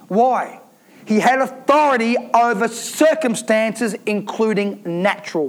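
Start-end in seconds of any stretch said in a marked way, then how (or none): none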